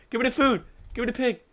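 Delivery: ordinary delivery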